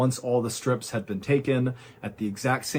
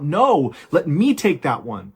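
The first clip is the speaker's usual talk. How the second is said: louder, higher protesting yell; 4 semitones higher, 6 dB louder than usual. A narrator's reading. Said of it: "he yelled out".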